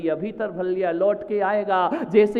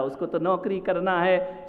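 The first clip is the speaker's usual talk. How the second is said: ordinary talk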